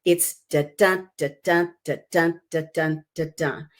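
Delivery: "da dun" sung out as a steady iambic beat, light then stressed; iambic rhythm. Shown as a demonstration. Teacher to students showing the scansion, beating out "da dun" evenly. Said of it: "that's iambic pentameter in there".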